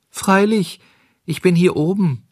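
very high tiny creature; fine little voice. A narrator's reading. Cricket tells visitors to open up, fine voice. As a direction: pipe up in a small high voice. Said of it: "rief eine feine Stimme".

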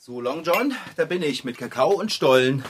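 ordinary talk, nothing marked

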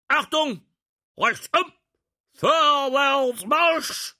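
shouted parade-ground orders; barked drill commands. Acted. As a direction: bark the commands, drawn out and loud. Prince barks drill orders. (gebellte Exerzierbefehle)

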